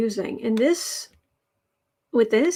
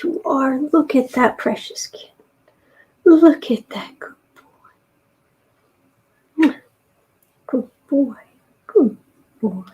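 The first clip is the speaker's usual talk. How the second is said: high, louder gushing praise; praising cat; 3 semitones higher, 5 dB louder than usual. Real, not acted. Owner gushing praise at her cat.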